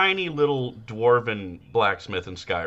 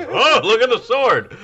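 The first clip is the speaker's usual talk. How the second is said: higher, louder mock exclamation; put-on voice; 10 semitones higher, 6 dB louder than usual. Podcaster imitating the champ gushing to a teenager, mock voice. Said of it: "he went up and did the voice".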